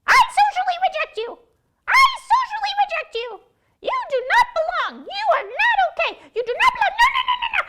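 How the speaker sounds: very high, loud screeching shouts; high, loud mocking crow; shrill crow rejection.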